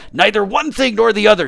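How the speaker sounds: loud, high, forceful correction; exasperated disembodied voice; impatient unseen voice. Acted. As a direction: cut off his excuses loudly. Voice impatient with tramp.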